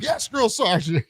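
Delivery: high, slow barked "yes, drill sergeant"; barked military recruit reply. Retold or imitated. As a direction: snap back like a recruit at attention.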